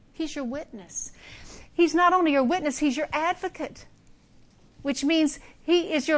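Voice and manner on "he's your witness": soft whispered assurance. Acted. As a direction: whisper it close, like a secret comfort. Tender assurance to the congregation.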